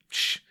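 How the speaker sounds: soft, drawn-out shush; imitated shushing. Quieting extras while others do their dialogue, imitated shushing. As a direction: mimic hushing people on a set.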